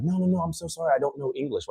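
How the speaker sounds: quiet, high mock apology; apologetic. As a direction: act out a humble, flustered apology. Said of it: "these people would apologize to me".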